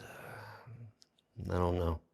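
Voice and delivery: meek voiceless whisper; sheepish whisper. Retold or imitated. Warden answering Gordy, sheepish whisper. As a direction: answer meekly, barely voiced. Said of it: "the warden goes".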